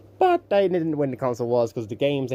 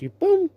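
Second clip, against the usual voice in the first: mock boom; 3 dB louder than usual. Slingshot launch sound.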